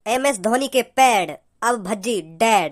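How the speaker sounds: slow villain punchline, dropping low at the end; dramatic dialogue. Acted. Gangster's son delivers his catchphrase to Bhajji, slow dramatic dialogue. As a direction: draw out the threat like a film dialogue. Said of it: "मैं एक dialogue बोल रहा हूँ".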